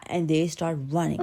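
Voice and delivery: usual speaking voice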